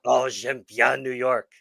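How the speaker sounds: mock French-accented gushing, slow; gushing Parisian. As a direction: drawl it out like a swooning Parisian. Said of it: "the number of Parisians".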